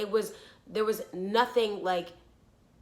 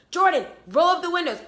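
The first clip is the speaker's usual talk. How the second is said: louder, higher order to sister; retold urgent call; 6 semitones higher, 6 dB louder than usual. Urgent order to sister in scary story.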